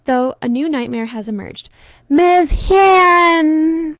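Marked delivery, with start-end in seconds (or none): 2.1-4.0 much louder, higher shout of her name; yelling. Acted. Boss yells for Hyun across the office, loud.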